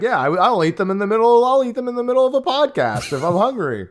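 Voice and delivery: high, bright sponsor pitch; ad read voice. Pretend. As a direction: sell it like a commercial. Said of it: "It's gonna be used to be like".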